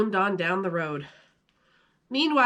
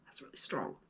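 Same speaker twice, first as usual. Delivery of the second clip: real whisper, much softer, off-script; whispered aside; 7 semitones lower, 17 dB quieter, faster than usual. Real, not acted. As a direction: mutter it under your breath.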